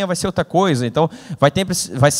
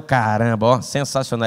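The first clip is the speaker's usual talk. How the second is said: ordinary talk, nothing marked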